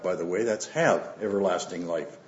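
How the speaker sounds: usual speaking voice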